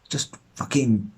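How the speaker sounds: usual speaking voice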